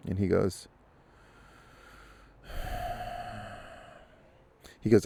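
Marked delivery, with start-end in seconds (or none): 2.5-3.4 exasperated sigh. Acted. Jogger exasperated, giving in.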